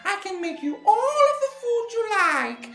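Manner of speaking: very high, sing-song luring voice; nice old lady. A narrator's reading. Witch luring children with food, falsely nice old lady. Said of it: "her best nice old lady voice".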